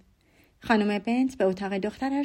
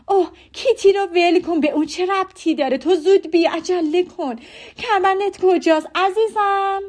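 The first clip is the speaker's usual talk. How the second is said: high, loud and fussing; mother's loud impatient fussing; excited mother; 8 semitones higher, 9 dB louder than usual. A narrator's reading. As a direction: brush off the question and hurry her along. Mother keeps hurrying Jane.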